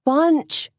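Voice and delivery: high desperate cry; cried out. A narrator's reading. Suzanne crying out to the sheriff, high desperate call. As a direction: call his name across the crowd. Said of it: "she cried again".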